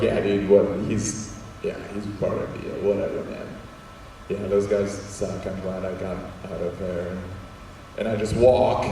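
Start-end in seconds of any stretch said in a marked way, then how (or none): 0.0-7.2 nervous mumble. Retold or imitated. Nervous brushing off to Kurt.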